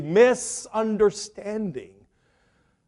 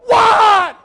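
much higher, louder shouted what; startled shout; 11 semitones higher, 6 dB louder than usual. Retold or imitated. Husband answering his wife's scream, startled loud shout. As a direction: bark it back, startled.

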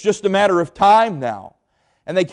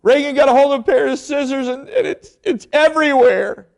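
imitating wife bawling; 10 semitones higher, 3 dB louder than usual. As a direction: drag it out like she can barely speak. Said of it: "she was bawling, crying".

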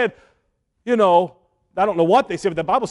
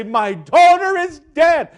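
mock terror; 7 semitones higher than usual. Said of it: "That terror gripped his heart".

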